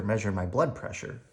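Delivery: ordinary delivery, nothing marked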